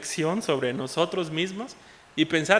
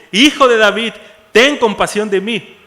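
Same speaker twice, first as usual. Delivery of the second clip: full-voiced desperate shout; shouting even louder; 4 semitones higher, 15 dB louder than usual. Bartimaeus defying the crowd to reach Jesus, very loud shout. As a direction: throw it over the crowd that tries to hush him. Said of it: "se puso a gritar aún más fuerte".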